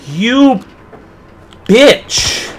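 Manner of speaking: high, loud cursing yell; angry shout. Real, not acted. Player cursing the enemy that startled them, angry shout. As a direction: shout it at your attacker, word by word.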